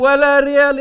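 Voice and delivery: loud mocking echo of her refusal; loud teasing repeat. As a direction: throw her words back loudly. Host echoes the refusal loudly.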